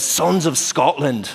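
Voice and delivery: drawn-out mock-Scottish war speech; bad Scottish accent. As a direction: attempt a Scottish accent, slowly. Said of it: "I have the worst Scottish accent".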